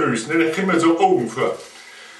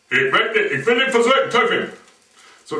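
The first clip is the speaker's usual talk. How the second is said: usual speaking voice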